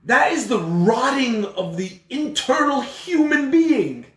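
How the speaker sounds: high, loud, slow moral outrage; preacher condemning jealousy at full force; preaching at full force. Real, not acted. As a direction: thunder it from the pulpit.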